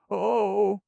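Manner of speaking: high, loud sobbing cry; a cry breaking into a sob; loud sobbing exclamation. Acted. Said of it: "sobbed Shirley".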